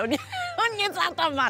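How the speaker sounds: laughing. Real, not acted. Laughs about ride instead of pool.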